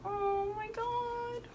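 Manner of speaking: very high, soft and drawn-out; soft, slow squeal of wonder; awed delight. Real, not acted. Seeing the thank-you card.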